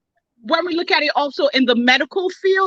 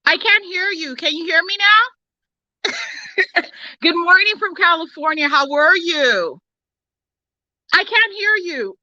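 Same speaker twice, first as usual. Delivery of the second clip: phone speaker; 5 semitones higher than usual. Real, not acted. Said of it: "so the speaker is on".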